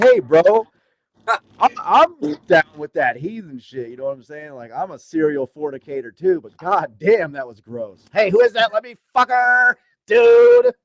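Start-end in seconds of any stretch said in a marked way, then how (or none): 0.0-3.9 higher, louder, hammed-up agreement; mock enthusiasm. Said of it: "I was like". 8.1-10.7 much higher, louder mocking voice of the roommate. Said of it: "He's like".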